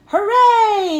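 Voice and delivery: high, loud cheer; crowd yell. A narrator's reading. Crowd cheering the pig back up, loud high yell. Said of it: "yelled the crowd".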